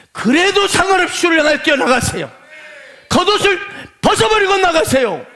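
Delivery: loud, high exhorting; preaching exhortation. Real, not acted. Pastor urging the congregation to run to Jesus, loud exhortation. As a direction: urge them forward with full force.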